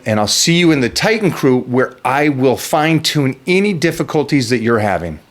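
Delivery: loud, pitched pitch for the meal plan; pumped ad read. Acted. Coach hyping his meal plan to the viewers, loud promo read. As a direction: sell it to the viewers with gusto.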